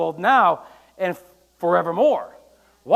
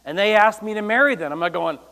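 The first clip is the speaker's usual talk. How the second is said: ordinary delivery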